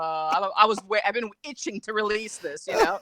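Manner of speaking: ordinary talk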